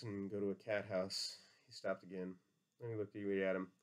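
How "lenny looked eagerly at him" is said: plain reading voice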